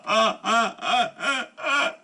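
choked wails breaking off; sobbing. Acted. A man weeping on his kneeling sworn brother's shoulder, sobbing. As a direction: sob the words out, unable to speak. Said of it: "是泣不成声啊".

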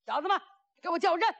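high, fast shouted order; rapid high-pitched command cry; battle command. Acted. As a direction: call the order out to the troops.

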